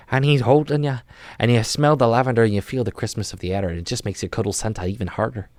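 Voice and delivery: playful, lilting put-on Irish brogue; wobbly mock Irish accent. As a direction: narrate like a wee Irish leprechaun. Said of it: "I turned Irish for a second there".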